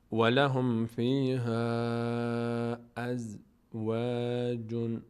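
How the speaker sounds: very slow, drawn-out chant; Quran recitation. Shown as a demonstration. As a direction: intone "ولهم فیها ازواج" slowly. Recites verse slowly.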